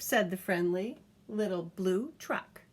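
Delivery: lively picture-book reading; reading to a child. A narrator's reading. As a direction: read it aloud to a toddler, bouncy and clear. Reads picture book aloud.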